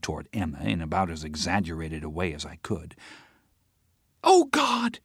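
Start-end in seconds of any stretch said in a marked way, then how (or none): 4.2-5.0 sudden high loud cry; cried out. Acted. Teresa catches herself in front of Emma, crying out. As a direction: burst out in dismay. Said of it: "she cried".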